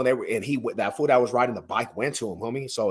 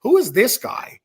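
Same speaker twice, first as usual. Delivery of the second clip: high, louder bewildered question; mock bewildered; 11 semitones higher, 6 dB louder than usual. Locals staring at the newcomer, high bewildered voice. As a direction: act out the stares of the locals. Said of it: "They're like".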